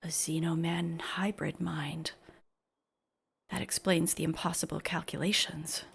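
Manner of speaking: softly whispered in awe; awed whisper. Acted. A character marvels at the patient's mind, in a whisper. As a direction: breathe it as a revelation.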